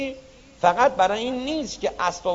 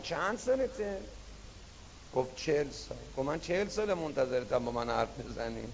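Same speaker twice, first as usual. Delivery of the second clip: quiet voice of God; 6 semitones lower, 8 dB quieter than usual. Acted. God speaks quietly to the climber.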